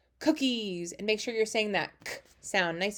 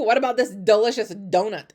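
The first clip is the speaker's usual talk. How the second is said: ordinary talk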